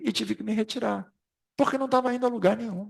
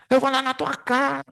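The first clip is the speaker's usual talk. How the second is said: higher-pitched mock challenge; mock quarrel threat; 6 semitones higher than usual. Speaker mimics men squabbling over paint colour, mock-combative. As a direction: imitate a petty squabbler picking a fight.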